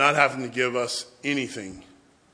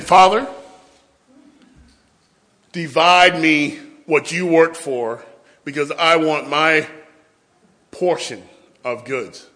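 pleading son; 6 dB louder than usual. Pretend. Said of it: "I can imagine me going to my dad and saying".

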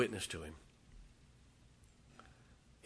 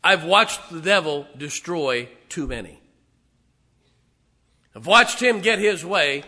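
loud preacher's cry, louder; fiery preaching; 6 semitones higher, 16 dB louder than usual. Real, not acted. Preacher warning his church, at full force.

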